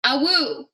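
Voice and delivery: mock dog howl, drawn out. Shown as a demonstration. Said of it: "Pokey, repeat after me".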